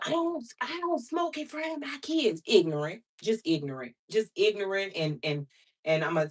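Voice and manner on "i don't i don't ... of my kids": high mocking whine of the ex; ex-husband mocked in a high voice. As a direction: mimic him high and dismissive. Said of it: "he was, he wa".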